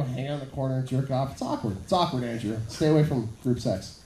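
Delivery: low, mock stern advice; mock stern warning. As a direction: warn him like a dad. Mock-warning Andrew lower voice.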